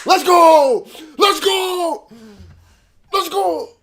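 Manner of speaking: loud, high triumphant yell; excited cheer. Real, not acted. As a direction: shout it like a win. Celebrating getting back in.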